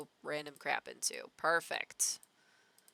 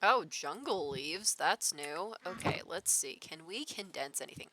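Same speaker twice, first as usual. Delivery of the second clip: ordinary talk, nothing marked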